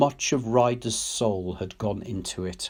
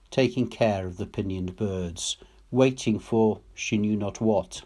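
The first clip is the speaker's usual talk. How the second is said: plain reading voice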